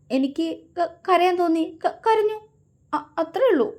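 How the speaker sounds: high, broken, stuttering sobs; sobbing. Acted. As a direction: cry it out between catches. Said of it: "അവൾ തേങ്ങിക്കൊണ്ട് പറഞ്ഞു".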